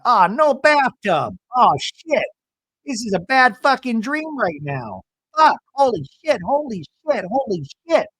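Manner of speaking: mock despair at a missing bathtub; mock panic. Panicked husband finds no bathtub.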